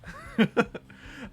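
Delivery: embarrassed laugh. Real, not acted. Embarrassed after impression.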